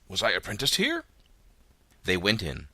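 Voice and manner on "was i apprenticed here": amazed question. A narrator's reading. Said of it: "said Scrooge".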